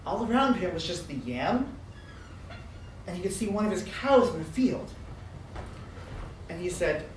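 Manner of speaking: ordinary delivery, nothing marked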